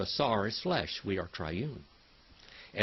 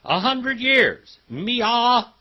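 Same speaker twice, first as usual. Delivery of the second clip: high, loud, slow voicing of the man's thought; teacher acting out the old man's thought, high and loud; quoted inner voice; 11 semitones higher, 12 dB louder, much slower than usual. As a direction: sing out his private thought high and big. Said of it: "He's thinking this to himself".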